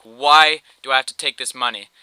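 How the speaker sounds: loud, fed-up "why"; loud exasperated protest at the dad. Retold or imitated. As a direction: push back at him, exasperated. Arguing with dad over money.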